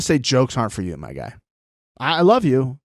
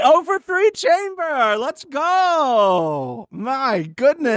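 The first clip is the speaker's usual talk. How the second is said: mock announcer; 12 semitones higher, much slower than usual. Mocking the failed chamber.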